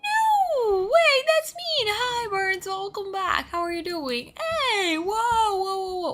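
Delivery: very high-pitched flustered protest turning into a greeting; real excited squealing. Real, not acted. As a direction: squeal it out high, easing slightly as you welcome them. Greeting raiders, protesting a mean message.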